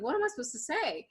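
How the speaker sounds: high, quick retold shock. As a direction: blurt it out like someone caught off guard.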